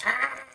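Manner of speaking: high little squeal of delight; a sudden excited squeak. Real, not acted. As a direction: yelp it out high and quick. Squeal after goblin bit.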